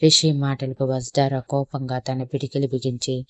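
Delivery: usual speaking voice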